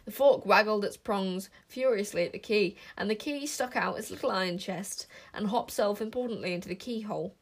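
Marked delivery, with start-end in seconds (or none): none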